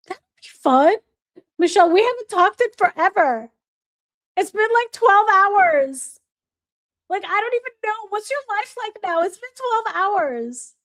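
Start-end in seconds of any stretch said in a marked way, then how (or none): 1.6-10.7 high, hammed-up outrage over nothing; mock dramatic. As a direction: play it like it's been years. Joking she hasn't talked forever.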